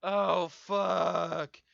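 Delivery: loud, high, crying-out panic; reliving a desperate cry of fear; panicked yell. Retold or imitated. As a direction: shout it like you think you are dying. Retold panic while violently ill.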